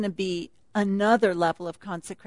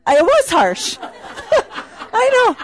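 laughing; 12 semitones higher, slower than usual. Real, not acted. Laughing at the GPS joke.

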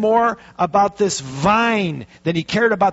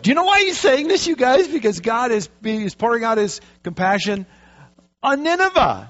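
much higher and faster, worked up; excited preaching; 6 semitones higher than usual. Real, not acted. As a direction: throw the question at the crowd, fired up.

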